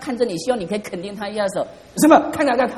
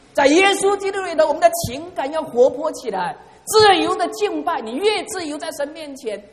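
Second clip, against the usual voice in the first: much higher, proclaiming; rousing preaching; 9 semitones higher than usual. Acted. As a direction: lift the congregation with every line. Preaching at full force. (高亢地讲道)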